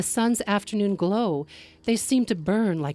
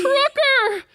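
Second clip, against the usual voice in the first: far higher, loud scream of the name; terrified cry; 7 dB louder than usual. Acted. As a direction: shout it in horror. Traveler realizes tree attacks.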